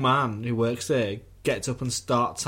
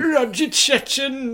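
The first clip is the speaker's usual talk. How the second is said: much higher, slow indignant cry; mock outraged mum; 8 dB louder, slower than usual. Mocking the reviewer's son.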